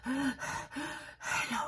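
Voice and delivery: panting hello after a jog; out of breath. Shown as a demonstration. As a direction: puff out the H, winded. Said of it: "Quand vous êtes comme ça".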